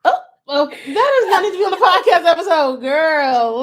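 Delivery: high, loud, laughing protest; real laughter through the words. Real, not acted. Reacting to an embarrassing remark.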